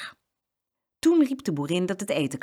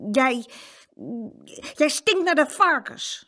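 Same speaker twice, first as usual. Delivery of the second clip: sulky retort; 8 semitones higher than usual. Acted. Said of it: "zei hij toen maar".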